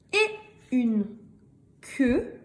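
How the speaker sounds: word by word, lifting at the end; slow reading model. Shown as a demonstration. Teacher reading aloud for beginning readers, slow and measured. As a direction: read it out in separate pieces.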